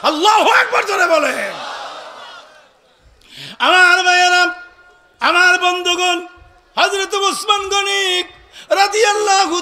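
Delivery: high, loud chanted exhortation; full-force preaching. Real, not acted. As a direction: draw out the calls and ring them out to the crowd.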